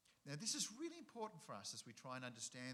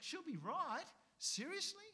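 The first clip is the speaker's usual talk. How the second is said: much higher, disbelieving echo; incredulous; 5 dB louder than usual. Retold or imitated. Preacher scoffing at the easy Australian brush-off, incredulous. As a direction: throw it back in disbelief.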